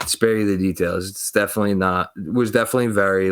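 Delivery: ordinary talk, nothing marked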